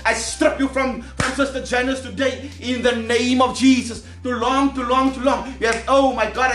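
usual speaking voice

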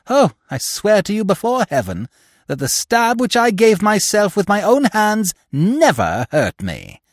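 vehement oath. Acted. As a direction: swear it with fierce conviction. Widow swears vehemently.

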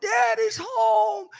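put-on high, stretched-out kid's call; excited high-pitched announcement; excited kids' cry. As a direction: call it out like kids spreading the news. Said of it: "the word would go out".

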